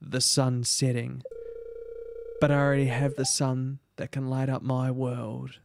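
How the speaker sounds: low, lingering dialogue read; reading love-struck suitor. Acted. Reads game dialogue.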